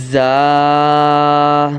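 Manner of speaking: زا with a strong sibilant z, held long; whistling زا. Shown as a demonstration. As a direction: buzz the z of زا, then stretch the vowel. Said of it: "تھوڑی سیٹی کریں گے". (زا سیٹی کی آواز کے ساتھ)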